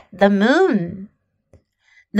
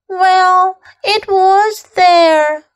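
put-on higher child voice, not truly whispered; timid little voice; 6 semitones higher, much slower than usual. A narrator's reading. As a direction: timidly insist like a little girl. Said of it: "whispered Amanda".

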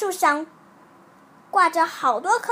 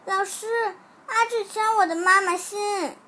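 higher, mock-crying child voice; tearful tattling; 6 semitones higher than usual. A narrator's reading. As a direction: complain to teacher with a touch of tears. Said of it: "每天都有小朋友哭着说". (孩子略带哭腔地告状)

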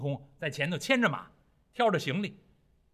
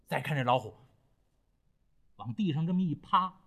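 ordinary delivery, nothing marked

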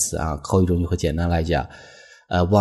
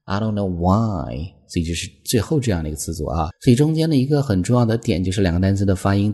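ordinary talk

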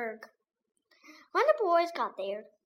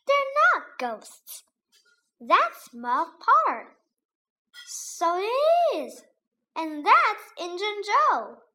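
surprised realisation; 8 semitones higher, 5 dB louder than usual. A narrator's reading. Boys recognise grave robbers.

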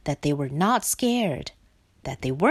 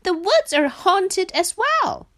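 high-pitched, alarmed warning; frightened Frog; 11 semitones higher, 3 dB louder than usual. Acted. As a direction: warn in a high scared voice. Frightened Frog warns Duck.

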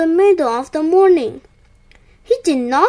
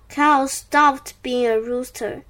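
usual speaking voice

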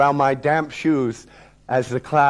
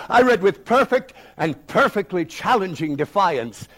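ordinary delivery, nothing marked

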